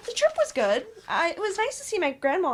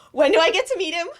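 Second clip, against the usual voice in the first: gasps, then asks eagerly; excited gasp; 3 semitones higher, 4 dB louder than usual. Acted. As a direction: burst in with delighted curiosity. Friend reacts to big news.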